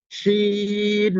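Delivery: শিন held long, filling the mouth; drawn-out shin. Shown as a demonstration. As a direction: stretch শিন so the whole mouth fills. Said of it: "আমার সাথে একটু try করবেন".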